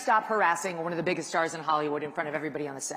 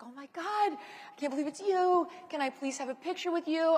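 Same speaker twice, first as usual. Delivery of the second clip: imitating a gushing fan, higher; excited fan; 9 semitones higher than usual. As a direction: lift the pitch like an eager fan asking for a selfie. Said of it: "he was so excited to see me".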